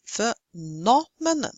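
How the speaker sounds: phe-NOM-enon, slowly, stress on NOM; teacher's demo of "phenomenon", NOM stretched. Shown as a demonstration. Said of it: "See, third syllable from the end".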